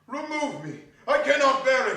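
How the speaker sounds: loud desperate cry; anguished plea. A narrator's reading. Scrooge crying out to the spirit, desperate. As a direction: plead to be released.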